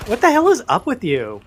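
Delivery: lightly imitated snotty teen girl; Valley Girl voice. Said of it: "that's my Valley Girl voice".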